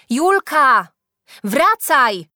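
high, loud summons; calling out. A narrator's reading. Teacher calls after runaway Julka, calling out. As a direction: holler as if down a corridor. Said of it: "Usłyszała wołanie nauczycielki".

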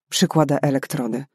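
usual speaking voice

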